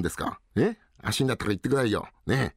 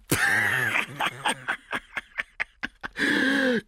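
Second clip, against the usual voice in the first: long shouted laugh; burst of laughter. Acted. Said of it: "やっぱり笑うじゃないか".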